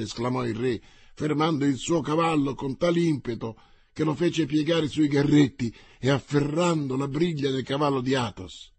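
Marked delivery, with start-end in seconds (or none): none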